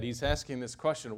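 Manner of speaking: ordinary talk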